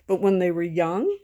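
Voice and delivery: ordinary talk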